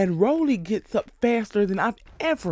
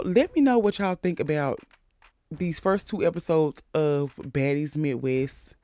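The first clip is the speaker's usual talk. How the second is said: normal talking voice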